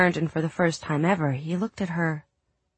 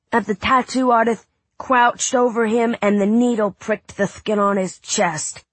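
put-on high excited boy reading aloud; a kid acting out a pirate tale; boy reading dramatically; 6 semitones higher, 5 dB louder than usual.

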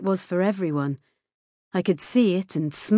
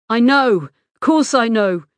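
loud, higher angry retort; angry outburst; 4 semitones higher, 8 dB louder than usual. A narrator's reading. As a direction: snap back angrily at the mother. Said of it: "He looked angry".

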